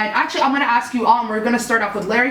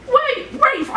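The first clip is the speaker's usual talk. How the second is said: high-pitched put-on British accent; mock British accent; 12 semitones higher than usual. Woman meeting her phone contact, mock British accent. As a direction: imitate a puzzled woman with British vowels. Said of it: "I'm just gonna do a British one".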